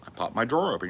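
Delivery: plain reading voice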